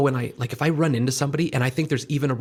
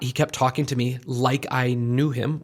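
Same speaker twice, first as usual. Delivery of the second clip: normal talking voice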